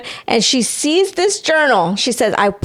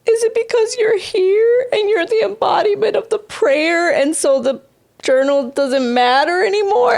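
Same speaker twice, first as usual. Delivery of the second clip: high quoting voice; 9 semitones higher than usual. Retold or imitated. Quoting her own question to God.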